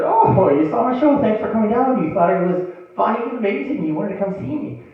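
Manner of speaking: high, gushing mock thanks; flattered delight. Acted reply to a fan.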